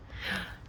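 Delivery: short quiet gasp. Real, not acted. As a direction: suck in a quick breath. Reacting to camera glitch.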